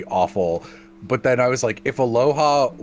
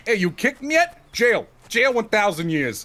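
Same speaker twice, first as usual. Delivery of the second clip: mock stern judge; 10 semitones higher than usual.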